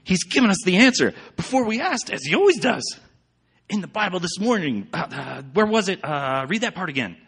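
high and loud, bursting with eagerness; Betsy thrilled to find the answer; eager excited Betsy; excitedly. A narrator's reading. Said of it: "she said excitedly".

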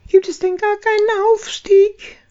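high, dismayed mouse call; stunned tiny mouse crying out; baby chick. Acted. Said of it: "rief die Haselmausbraut fassungslos". (fassungsloser, hoher Ruf)

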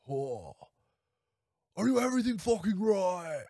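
high, badly faked actor voice; bad Nicolas Cage. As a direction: badly imitate a worked-up movie star's rant. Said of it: "I can't do his voice".

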